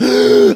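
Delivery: loud imitated gasp for air; sharp revival gasp, acted out; sudden gasp. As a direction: gasp like coming back to life. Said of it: "he just went".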